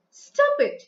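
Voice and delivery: high-pitched sharp command; mock yell. Shown as a demonstration. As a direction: yell a quick order as a demonstration. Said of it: "he yelled".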